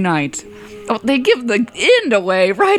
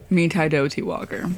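low, hushed spooky title read; 5 semitones lower, 6 dB quieter than usual. Pretend. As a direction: say the title like a ghost story. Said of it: "Even scarier".

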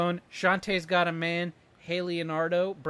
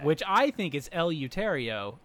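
ordinary talk, nothing marked

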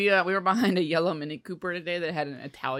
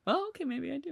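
much softer, sheepish giving in; sheepish mom; 6 semitones higher, 10 dB quieter than usual. Retold or imitated. Mom quietly caving about the feet pictures, soft sheepish tone. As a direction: mutter the mom's reluctant change of heart. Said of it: "that moment that she's like".

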